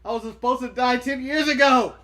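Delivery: loud, slow mock lament; weary old man. Imitating an ailing wrestling boss on Raw, mock despairing. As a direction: groan it out loudly, wishing it were over. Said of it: "he's just like, "Ugh".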